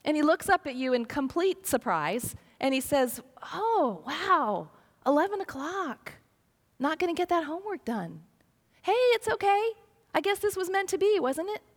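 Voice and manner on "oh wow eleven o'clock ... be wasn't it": put-on higher, airy careless teenage voice; a teenager caught out, brushing it off cheerfully; breezy teen excuse. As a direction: shrug it off like a carefree kid.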